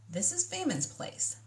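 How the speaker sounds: ordinary delivery, nothing marked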